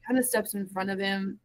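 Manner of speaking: ordinary delivery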